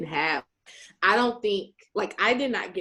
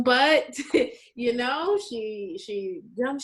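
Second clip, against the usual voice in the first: ordinary delivery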